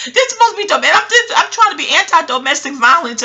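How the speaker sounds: high and loud, getting fired up; real agitation bursting out; heated, worked up. Real, not acted. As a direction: let the anger rise in your voice. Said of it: "I'm getting hot all over again".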